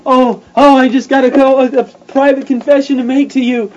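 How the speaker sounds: high, loud, hammed-up confessor; mock dramatic confession. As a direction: overplay a gushing private confession.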